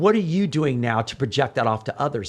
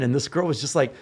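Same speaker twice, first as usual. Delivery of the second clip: normal talking voice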